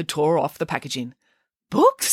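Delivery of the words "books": put-on high delighted child exclaim. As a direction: burst out excitedly, very high. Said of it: "he exclaimed".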